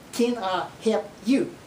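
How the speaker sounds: "Can I help you?" slow, "help" as "hep"; Okie accent. Shown as a demonstration. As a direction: drop the L in "help". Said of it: "our pronunciation of the word help".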